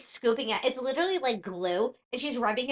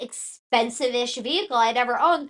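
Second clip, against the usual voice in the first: ordinary talk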